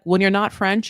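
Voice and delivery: ordinary delivery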